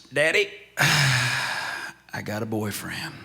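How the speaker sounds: put-on slow, sassy little girl with a sigh, hand on hip; a light imitation of a sassy five-year-old. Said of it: "she puts that hand on her hip".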